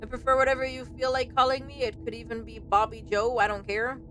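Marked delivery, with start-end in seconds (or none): none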